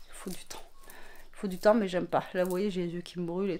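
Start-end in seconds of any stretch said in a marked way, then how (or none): 0.1-0.6 half to herself, in a whisper; whispered aside. Real, not acted. Repeats phrase, then says it aloud.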